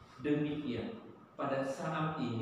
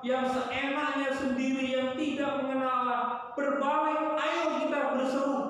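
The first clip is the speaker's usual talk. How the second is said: king's proclamation; 7 semitones higher than usual. Acted. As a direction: call the whole city to repent like a king. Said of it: "raja ini berkata".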